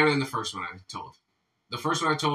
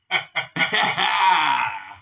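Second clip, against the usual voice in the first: laughing. Real, not acted. Joke teller cracks up after the punchline, laughing. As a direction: burst of laughter trailing out.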